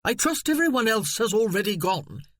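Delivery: a hint of a smug, condescending relative; mild snooty gentleman; pompous cousin. Cecil continues.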